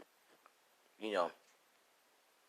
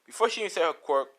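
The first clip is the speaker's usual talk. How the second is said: ordinary delivery, nothing marked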